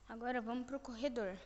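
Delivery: ordinary delivery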